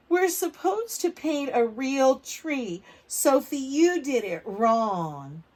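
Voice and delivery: put-on loud, slow, teasing kid; mocking classmate. Andrew jeering at Sophie's blue tree, loud and slow. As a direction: jeer it at her across the table.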